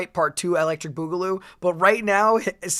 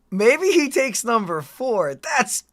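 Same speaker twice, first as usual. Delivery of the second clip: normal talking voice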